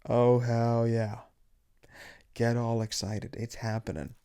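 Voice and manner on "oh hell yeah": long, loud drawl on each word; drawn-out hype. Acted. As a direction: stretch it out like a catchphrase. Drawn-out opening catchphrase.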